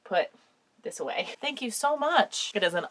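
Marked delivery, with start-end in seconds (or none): 1.4-2.5 choked up. Real, not acted. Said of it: "I'm getting choked up".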